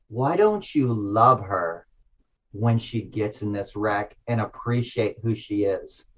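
soft, slow and low, like a calm inner voice; gentle divine voice. Retold or imitated. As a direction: let the voice of God speak tenderly and softly. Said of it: "this voice said this".

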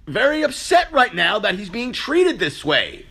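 high, excited voice of an airport agent catching on; hammed-up sudden recognition; mock realization. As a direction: play the dawning oh-it's-him moment. Imagined agent realizing his mistake.

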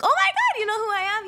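hammed-up starstruck outburst, loud at first; retold excited gushing. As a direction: gush it in a high, thrilled voice. Retold drunk meeting with someone famous.

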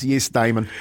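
ordinary talk

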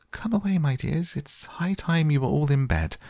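high, anxious trembling call. A narrator's reading. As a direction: call the children away, trembling. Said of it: "called out in a trembling voice".

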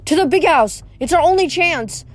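high, rallying cry; urgent call. A narrator's reading. Friends racing off by chariot, urgent call.

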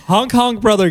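high laughing honk; honk honk call. Pretend. Repeats catchphrase laughing.

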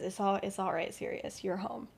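hushed, tender reassurance; soft comfort. Retold or imitated. James welcoming crying Sirius home, soft and tender. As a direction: softly tell him he's safe now.

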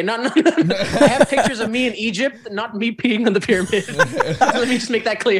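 talking through laughter, pitch lifted; laughing. Real, not acted. Clarifying his pyramid story to the hosts, laughing. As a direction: laugh while setting the record straight.

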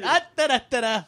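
high, loud echo of a ranter's gibberish; playful mock shout; mocking mimicry. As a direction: parrot the nonsense syllables while amused. Echoes the ranting actor's gibberish.

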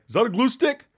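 high, sneering mock question; mocking jackass. Mocking a heckler about his chapstick, high and sneering. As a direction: jeer like a smug heckler. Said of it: "some jackass that's like".

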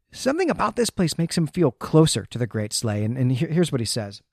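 ordinary talk, nothing marked

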